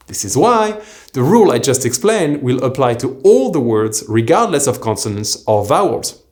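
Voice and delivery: usual speaking voice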